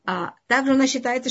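usual speaking voice

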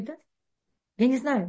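ordinary talk, nothing marked

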